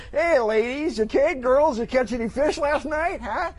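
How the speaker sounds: high, teasing heckle; mocking call to buddies. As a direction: needle your fishing pals. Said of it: "If I'd say".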